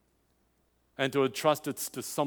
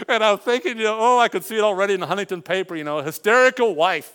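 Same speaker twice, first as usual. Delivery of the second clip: loud, high, rushing retelling of a panicky thought; storyteller swept up in the panic of the moment; excited retelling; 8 semitones higher, 11 dB louder, faster than usual. Retold or imitated. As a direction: blurt it out, half laughing at yourself.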